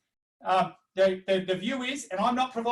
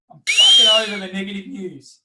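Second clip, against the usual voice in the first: much louder, exasperated blurt; real frustration bursting out; fed-up outburst; 11 dB louder than usual. Real, not acted. Fed up with bad news.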